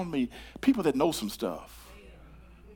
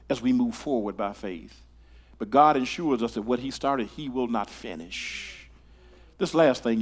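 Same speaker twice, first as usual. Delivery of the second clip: usual speaking voice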